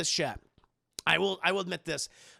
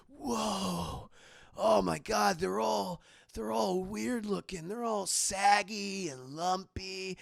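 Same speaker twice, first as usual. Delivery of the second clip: stunned awe; much slower than usual. Retold or imitated. Stunned drunk guys staring.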